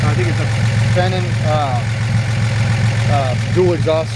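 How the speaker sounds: halting, stumbling over words; stuttering. Real, not acted. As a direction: stutter and search for the word. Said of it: "Sorry, I'm stuttering there".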